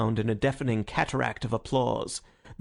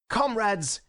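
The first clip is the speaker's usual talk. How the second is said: high, passionate call to the hall; crying out; 10 semitones higher than usual. A narrator's reading. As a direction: call out urgently to the comrades. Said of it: "he cried out".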